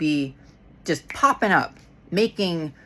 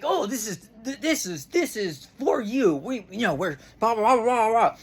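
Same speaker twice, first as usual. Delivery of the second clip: mock corporate pitch; 2 semitones higher, 3 dB quieter than usual. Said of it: "you know, like".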